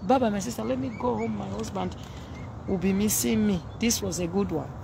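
ordinary talk, nothing marked

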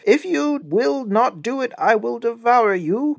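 slow, high-pitched wolf threat; threatening wolf. A narrator's reading.

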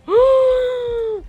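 long, loud, high gasp; horrified gasp. Real, not acted. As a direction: draw in a huge shocked breath. Trap gate broken.

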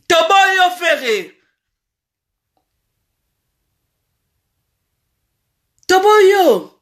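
slow, high, drawn-out shout; loud drawn-out cry of refusal; shouted rejection. Real, not acted. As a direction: holler the rejection, stretching each word.

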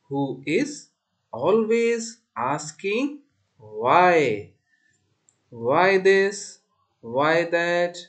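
slow, deliberate verse with stretched words; poem recitation. Shown as a demonstration. Teacher reciting a poem for class four, slow deliberate recitation. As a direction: recite it line by line, loud and measured.

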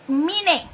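"Mine" said "MEE-neh", slowly; Spanish reading. Shown as a demonstration. Vlogger reads a viewer's name, "Mine" as "MEE-neh". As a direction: say "Mine" in two Spanish syllables. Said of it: "Así como se lee en español".

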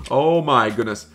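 ordinary delivery

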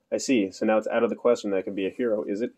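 plain reading voice

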